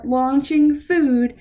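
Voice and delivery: ordinary delivery, nothing marked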